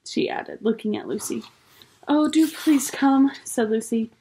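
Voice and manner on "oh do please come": high, drawn-out plea; pleading. A narrator's reading. Lucy begging Missus Beaver to leave at last, pleading. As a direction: beg in a higher, louder, slower voice.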